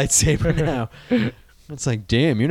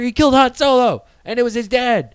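mock outrage; 9 semitones higher than usual. Real, not acted. Mock grief over Han Solo.

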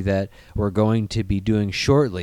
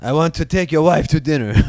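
higher, mock French accent; French pirate; 4 semitones higher, 6 dB louder than usual. Pirate asking to take a wife to dinner, mock French accent. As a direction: play a courting French pirate. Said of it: "what a French pirate does".

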